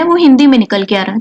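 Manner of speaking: usual speaking voice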